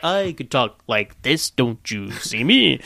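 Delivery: slow, drawn-out movie villain impression; Hugo Weaving. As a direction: stretch the words out slowly, imitating an actor's menacing delivery. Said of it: "doing a Hugo Weaving impression where it's like".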